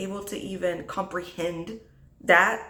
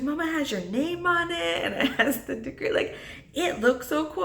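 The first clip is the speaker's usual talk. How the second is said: put-on higher, wide-eyed kid quote; excited child; 8 semitones higher than usual. Daughter reading mama's degree, excited and impressed. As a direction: imitate an impressed little girl.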